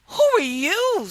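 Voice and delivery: high loud surprise; high loud surprised princess. Acted. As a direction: gasp the question, up high. Princess voiced in story.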